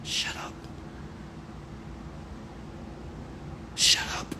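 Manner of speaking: hushed whispered shut up. Retold or imitated. Comedian silencing fake-proud folks, hushed whisper. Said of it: "I should be like".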